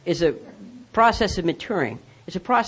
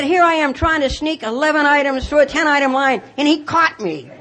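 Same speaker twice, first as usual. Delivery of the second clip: high-pitched sarcastic outburst; hammed-up righteous indignation; mock indignant; 8 semitones higher than usual. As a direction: re-enact a worked-up rant at the manager. Retold rant to store manager.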